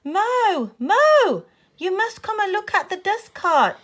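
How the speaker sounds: high, loud urgent calling; top-of-voice shout. A narrator's reading. Boy running back to his friend, urgent full-voice shout. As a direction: yell to summon someone from afar. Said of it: "shouting at the top of his voice".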